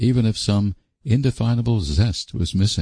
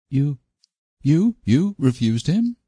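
blank disbelief; 10 semitones higher than usual. A narrator's reading. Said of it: "said Phil blankly".

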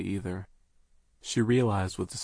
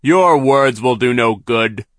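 slow, loud gloating villain; mock their useless pleas; 4 semitones higher, 15 dB louder, slower than usual. A narrator's reading. Villain foreman voiced in story.

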